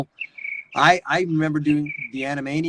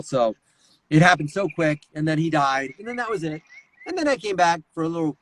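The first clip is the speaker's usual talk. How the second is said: normal talking voice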